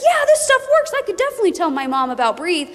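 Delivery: very high, eager start; high, enthusiastic convert; convinced friend. Retold or imitated. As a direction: sold and eager. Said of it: "they're like".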